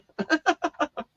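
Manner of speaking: high, soft laughter; giggle. Real, not acted. Husband joking he is pleasantly plumper, high soft laugh. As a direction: laugh lightly, pitched right up.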